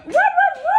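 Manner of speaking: high loud puppy howl woo. Acted. Puppy puppet bursts in.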